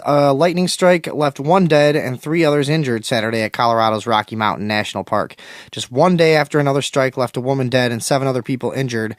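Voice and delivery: loud, stilted newsreader read; hammed-up news voice; bad news-read inflection. Shown as a demonstration. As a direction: read it like a clumsy anchor. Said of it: "I'm doing some really bad inflection on these fucking articles".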